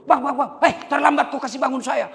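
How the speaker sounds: sudden loud wake-up, then a scolding; startled scolding. Acted. Husband woken too late near asar, loud and laughing.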